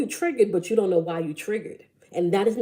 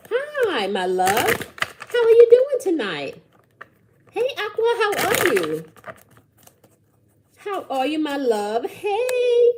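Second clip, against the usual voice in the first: much higher, bright greeting to viewers; excited greeting; 10 semitones higher, 4 dB louder than usual. Real, not acted. Host greeting live viewers by name, excitedly high. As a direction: gush hello to the chat.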